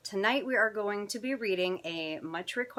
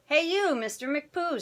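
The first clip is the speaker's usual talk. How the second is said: higher-pitched mock telling-off; scolding mama; 7 semitones higher, 4 dB louder than usual. Mama to her boy at the litter box, scolding. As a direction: play a mother calling out her naughty boy.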